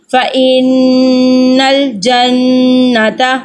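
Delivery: slow recitation with held doubled nuns in فَإِنَّ الْجَنَّةَ; ghunna on the nuns. Shown as a demonstration. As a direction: linger on each doubled nun. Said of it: "ہم نے پھر دوبارہ سے گننا یہاں پہ کیا ہے". (فَإِنَّ الْجَنَّةَ میں غنہ کے ساتھ آہستہ تلاوت)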